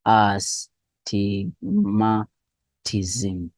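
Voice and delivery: very slow, syllables of "astigmatism" pulled apart. Shown as a demonstration. Teacher breaks down a hard term.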